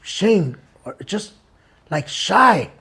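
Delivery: soft, slow and shrinking; shy. Retold or imitated. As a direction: say it like a bashful brother. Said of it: "the other one is like". (tímido y avergonzado, bajito)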